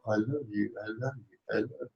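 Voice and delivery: soft, hushed mock tenderness; a mocking, softly spoken I love you to God; mock sweet denial. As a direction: coo it like a hollow reassurance. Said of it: "and they go".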